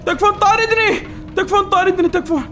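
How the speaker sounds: high, loud cry for help while chased; panicked plea. Real, not acted. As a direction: beg in a panic. (استغاثة مذعورة عالية)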